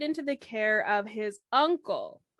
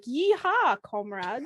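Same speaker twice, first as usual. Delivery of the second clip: lightly put-on Russian accent with a cowboy yeehaw; cowboy cheer in a weak Russian accent; slower than usual. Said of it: "If only I could do a better Russian accent".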